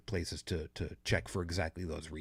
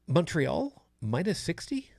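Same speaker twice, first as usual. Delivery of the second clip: incredulous; 9 semitones higher, slower than usual. Real, not acted. Scoffing at the forecast figure.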